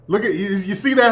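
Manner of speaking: normal talking voice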